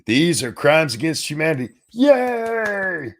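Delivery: sarcastic crowd cheer, squealed high; mock the audience applauding; high mocking yay. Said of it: "You should".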